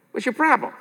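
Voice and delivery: ordinary delivery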